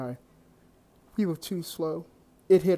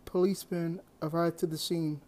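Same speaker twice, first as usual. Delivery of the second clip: ordinary delivery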